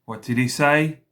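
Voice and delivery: usual speaking voice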